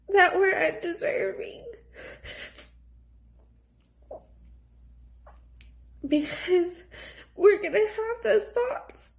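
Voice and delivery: crying through every word; snotty crying. Real, not acted. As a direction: voice breaking, high and teary. Tearful talk about infertility.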